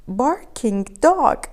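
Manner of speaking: ordinary delivery, nothing marked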